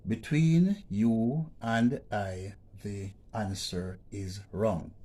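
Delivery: "Between you and I" read slowly and loudly, "Between" stressed; slow read-out of the sentence. Shown as a demonstration. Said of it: "Next sentence".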